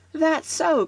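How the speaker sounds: high-pitched chorus of agreement; crying out. A narrator's reading. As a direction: chime in with a high little cry. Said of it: "cried the others".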